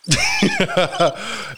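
a real, bitter burst of laughter; pained laugh. Real, not acted. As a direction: laugh it off but let the hurt show. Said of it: "A lot of pains behind that laughter".

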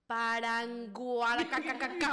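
slow, exaggerated sounding-out of a word; imitating helpful teachers. Teachers trying to help spelling contestants, mock helpful sounding-out. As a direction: overdo the syllables like a teacher dropping hints. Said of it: "las profesoras era como que te querían ayudar y te decían".

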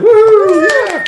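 very high, loud whoop; excited cheer. Real, not acted. Host thanking Justin for his review, excited whooping. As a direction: let out a big celebratory "woo!".

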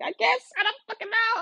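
very high, frantic squeal; retold frantic answer. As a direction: squeal it helplessly, hammed up.

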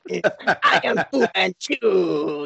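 slow, drawn-out villain voice; bad guy voice. [name] showing off his new bad guy voice, slow and hammy. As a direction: ham up the bad guy, slowly. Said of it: "the bad guy will talk like this".

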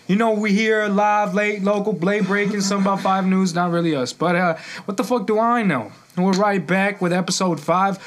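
upbeat podcast host intro; show host intro. Acted. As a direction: hype up the show opening.

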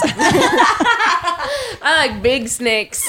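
laughing mock purr. Mock sultry joke.

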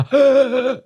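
sudden high gasp for air; a high sucking gasp; sharp gasp. Shown as a demonstration. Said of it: "like that".

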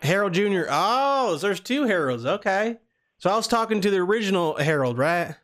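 high-pitched Southern prank caller; Southern accent. Pretend. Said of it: "we're doing the Southern accent".

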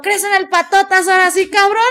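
high, loud, threatening yell; menacing shout. Retold or imitated. Woman taunting the scared guy, loud and menacing. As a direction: roar the threat at him.

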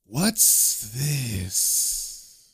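low, drawn-out, otherworldly question; eerie demon king arriving; third demon voice. A narrator's reading. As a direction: let the words seep out slowly. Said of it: "we hear a third voice".

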